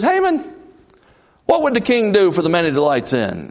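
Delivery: high ringing call of a name; high commanding king. Retold or imitated. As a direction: summon him from across the hall. King calls Haman.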